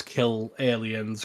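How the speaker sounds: usual speaking voice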